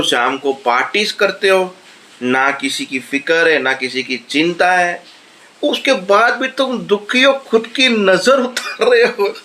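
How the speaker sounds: builds to a high, exasperated peak on the last lines; incredulous rant. Real, not acted. Vlogger ranting at the brothers' self-pity, building incredulous. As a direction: climb into disbelief at their self-pity.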